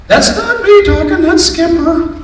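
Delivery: very high talking-dog voice; Skipper. Acted. Grandpa as his dog denying it to granddaughter, high voice. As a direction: pitch it way up, loud, as the dog. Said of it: "why are you talking in that high voice".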